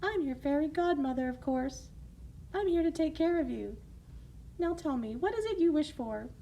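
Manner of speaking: high, sweet motherly voice; kind fairy godmother. Acted. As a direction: reassure her gently.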